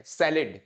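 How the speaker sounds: सैलेड repeated as SAL-id, falling; correct English pronunciation. Shown as a demonstration. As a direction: drill सैलेड with short 'id'. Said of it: "इसको सैलेड बोला जाता है". (सैलेड, 'सैलिड' जैसा सही उच्चारण)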